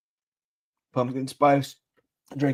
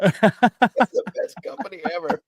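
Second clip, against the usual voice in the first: sarcastic; 3 semitones higher than usual. Acted. Sarcastic review reaction.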